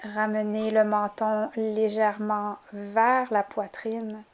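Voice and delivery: soft, unhurried yoga nidra voice; hushed relaxation instructions with calm pauses; guided relaxation. Acted. Begins guiding the relaxation.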